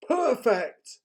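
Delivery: high, loud, slow troll planning; the troll pleased with his plan; scheming troll. A narrator's reading. Troll voice in read story.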